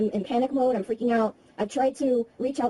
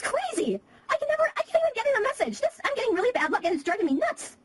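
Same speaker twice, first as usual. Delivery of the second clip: high, frustrated ranting; venting at bad luck; exasperated rant; 10 semitones higher than usual. Real, not acted. Phone battery dies mid-call.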